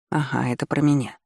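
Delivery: ordinary delivery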